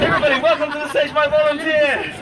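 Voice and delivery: loud, high showman announcement; ringmaster. Acted. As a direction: announce it to the whole crowd.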